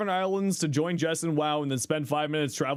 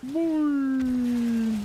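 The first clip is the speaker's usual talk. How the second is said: long drawn-out boing; springy boing sound; 7 semitones higher, 3 dB louder than usual. Pretend. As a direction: stretch the boing out.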